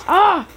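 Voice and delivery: short loud exasperated "ah"; venting after the teacher's call; frustrated yell. Real, not acted. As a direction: let out a fed-up cry.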